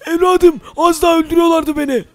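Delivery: put-on high, shaky-free old man's voice, pitched well up; old shopkeeper voice. Mehmet Usta, nearly killed, speaks to Metin in a high old voice. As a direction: play the old shop master, pitched high.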